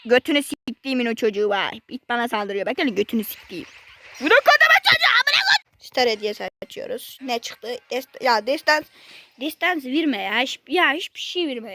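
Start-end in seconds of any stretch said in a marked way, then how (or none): 4.2-5.7 sudden high-pitched yell of outrage; frustrated yell. Real, not acted. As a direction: shriek it in disbelief. Kid ambushes him in game.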